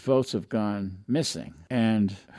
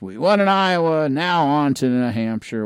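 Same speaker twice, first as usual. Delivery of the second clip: higher and louder, a rally cry for "We won in Iowa"; imitating a victory cheer; 5 semitones higher, 7 dB louder than usual. Host imitating a campaign declaring victory, excited. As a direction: shout it like a campaign celebrating the win. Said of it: "what you want is".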